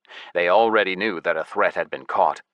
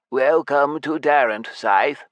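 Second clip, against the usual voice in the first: slow, higher-pitched clerk voice; scribe's welcome; 6 semitones higher, slower than usual. A narrator's reading. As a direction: formally welcome a newcomer, unhurried. Scribe formally welcomes newcomer.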